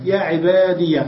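"عبادي" said "ibadiya"; ya with fatha. Shown as a demonstration. Teacher isolating the verse example, -iya ending. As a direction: end "عبادي" on a clear -iya. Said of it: "بفتح الياء".

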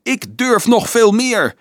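slow, very high, loud shout; frantic shout. A narrator's reading. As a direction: shout it beside himself with rage. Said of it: "schreeuwde Dolf over zijn toeren".

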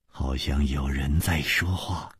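soft, slow murmur; hushed. A narrator's reading. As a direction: speak low so as not to be heard. Listening at a wall. (压低声音慢慢说)

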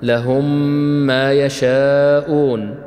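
لهم ما يشاءون slowly recited, the two م held as one hum; merged, held م. Shown as a demonstration. Teacher reciting a Quranic example of idgham, merged held م. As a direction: join لهم to ما on a long doubled م, stretching the vowels of يشاءون. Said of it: "الإدغام، الإدغام الصغير".

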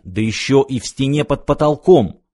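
plain reading voice

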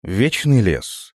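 usual speaking voice

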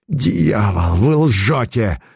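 slow, rasping accusation; hoarse rasp. Acted. The adventurer rasping at Putilin, hoarse and slow. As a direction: croak it out, choking with rage. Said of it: "прохрипел авантюрист".

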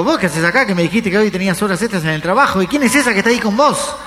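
high, rising jealous tirade; acted outraged woman; indignant counter-accusation. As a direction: confront a partner caught out. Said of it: "ella le dijo".

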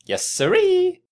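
squeaky, very high, loud "yes sirree"; comically high jolly exclamation; hearty booming country squire. Pretend. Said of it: "My posh gentleman".

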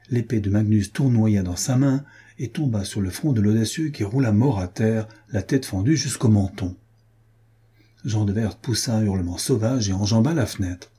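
usual speaking voice

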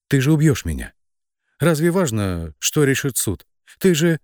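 frantic high protest; panicked protest. A narrator's reading. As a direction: plead urgently, pitch climbing.

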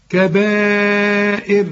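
"كبائر" with the alif held four beats before the hamza; slow, stretched "كبائر" with a drawn-out alif; long madd. Shown as a demonstration. Quran teacher demonstrating the required madd in "كبائر". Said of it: "أهو ده المد".

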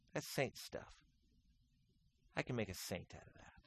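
soft unvoiced whisper; whispered God voice. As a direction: breathe it as a secret.

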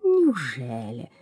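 slow, drawn-out fake gasp; mock surprise. A narrator's reading. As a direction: feign astonishment slowly. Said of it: "С притворным удивлением ахнул кот".

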